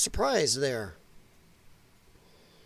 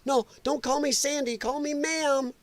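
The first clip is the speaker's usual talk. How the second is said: high mocking quote of a fussy teacher; mocking prissy voice; 7 semitones higher, 4 dB quieter than usual. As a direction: sneer it back in a high put-on voice. Mocking the video's speaker.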